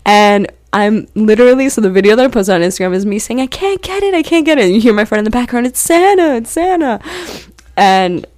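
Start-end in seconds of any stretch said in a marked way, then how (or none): 3.4-4.6 high-pitched imitation of frantic frustration; mock frantic cry. Quoting self failing to film the lights, mock frantic cry. Imitates own freaked-out video voice. 5.6-7.0 high-pitched mock excitement; imitated excited friend. Imitating a friend in the video's background, mock excited cry.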